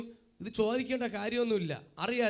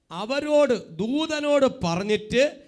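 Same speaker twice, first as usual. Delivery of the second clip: emphatic preaching; 7 semitones higher, 7 dB louder than usual. Real, not acted. Preacher walks congregation through Mary turning back, emphatic preaching. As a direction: hammer each phrase after the reader.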